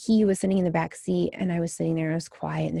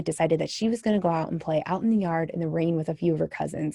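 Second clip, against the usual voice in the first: ordinary talk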